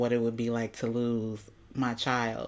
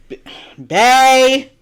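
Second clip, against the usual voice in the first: loud high call to bae; sudden loud call; 11 semitones higher, 14 dB louder than usual. Real, not acted. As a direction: shout across the room for bae. Calling out to partner loudly.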